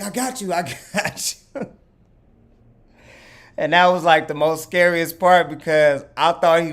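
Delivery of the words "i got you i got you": high, laughing reassurance; reassuring laugh. Retold or imitated. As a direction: chuckle while calming her. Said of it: "he's like".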